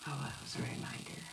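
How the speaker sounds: whispered, quick private aside; murmured note to self while live. Real, not acted. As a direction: whisper it off-mic to yourself. Aside off the stream.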